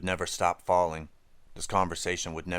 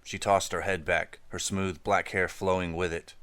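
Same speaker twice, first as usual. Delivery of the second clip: ordinary delivery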